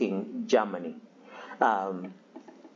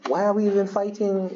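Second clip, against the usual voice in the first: higher, bewildered mock voice; puzzled soldiers' question; 8 semitones higher than usual. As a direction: ask it as a baffled soldier would. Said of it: "it's sort of like".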